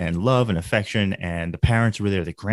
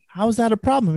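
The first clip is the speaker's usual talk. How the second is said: high-pitched comic question; Gilbert Gottfried impression; 13 semitones higher than usual. Storyteller mimics comedian voicing the doctor, high impression. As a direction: toss it back in a high joke voice. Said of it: "He goes".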